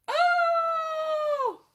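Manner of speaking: high, sudden startled cry; frightened shriek. Acted. Miss Muffet frightened by spider.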